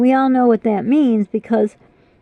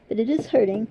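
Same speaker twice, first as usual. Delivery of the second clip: ordinary talk, nothing marked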